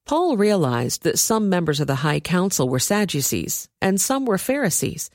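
plain reading voice

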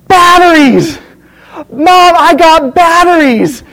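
loud, high, stretched-out cry of joy; thrilled child shouting. Retold or imitated. Adam shows mom his Christmas gift, ecstatic imitated shout. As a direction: imitate an overjoyed boy yelling.

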